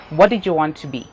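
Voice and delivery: usual speaking voice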